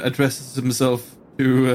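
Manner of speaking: ordinary talk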